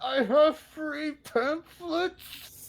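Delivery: high, very slow salesman voice; hammed-up preacher offering pamphlets; pushy pamphleteer. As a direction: press the pamphlets on them. Player continues preacher bit.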